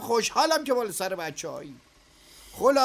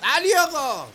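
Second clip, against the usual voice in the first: louder call, higher; 3 semitones higher, 7 dB louder than usual. Acted. Boss calling the worker back as he walks out, loud call. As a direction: call him back before he leaves.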